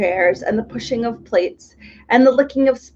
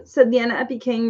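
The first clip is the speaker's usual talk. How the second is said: ordinary delivery, nothing marked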